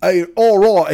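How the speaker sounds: ordinary delivery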